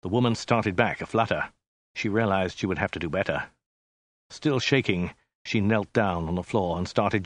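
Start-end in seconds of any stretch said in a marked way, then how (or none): none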